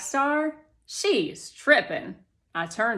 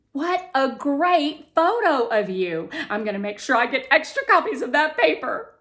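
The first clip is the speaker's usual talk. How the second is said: higher, louder and fast, chattering; cheerful chatter; 6 semitones higher, 8 dB louder than usual. Acted. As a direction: rattle it off happily. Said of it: "she chatters cheerfully".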